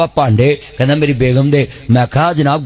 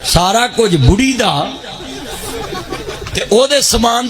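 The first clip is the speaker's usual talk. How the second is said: laughing; 8 semitones higher than usual. Real, not acted. Laughing at the story.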